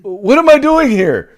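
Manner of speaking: hammed-up confused old man; mock lost Biden. Repeating Biden bit.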